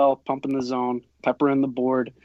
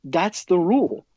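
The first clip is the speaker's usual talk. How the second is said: raised-pitch umpire telling off a parent; retold firm retort; 6 semitones higher than usual. Umpire turns around to answer the yelling mother, retold firm retort. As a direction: replay a firm, exasperated comeback, pitched up.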